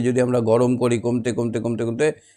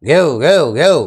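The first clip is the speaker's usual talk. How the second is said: put-on old dog bark; 6 dB louder, slower than usual. Shown as a demonstration. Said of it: "আর বুড়ো কুকুর". (বুড়ো কুকুরের ভারী ঘেউ ঘেউ)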